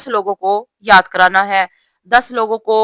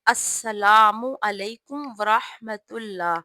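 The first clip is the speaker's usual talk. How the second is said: slow, softer السلام علیکم ورحمۃ اللہ; slow correct salaam; 5 dB quieter, slower than usual. Shown as a demonstration. Teacher showing the correct salaam, slow. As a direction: say the salaam slowly, word by word. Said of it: "سلام کا صحیح طریقہ یہ ہے".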